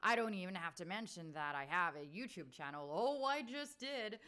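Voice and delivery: Australian doing a big American read; broad American accent, slow and showy; hammed-up American review read. Shown as a demonstration. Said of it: "I'm gonna do it in my American accent".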